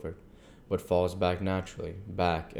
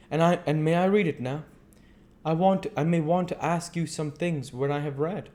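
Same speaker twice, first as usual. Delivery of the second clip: higher, louder voice for the earnest foreign doctor; earnest old doctor; 10 semitones higher, 4 dB louder than usual. Acted. The doctor to Mina, explaining his visit, grave and courteous.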